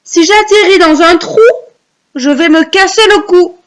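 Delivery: high, projected poem lines; recite for repeating. Shown as a demonstration. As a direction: call out each verse for children to echo. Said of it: "vous allez réciter après moi".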